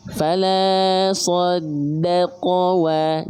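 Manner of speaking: fala saddaqa wa slowly chanted, lips rounded on wa; Quran recitation. Shown as a demonstration. Teacher recites the verse for students. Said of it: "pastikan dia muncungkan".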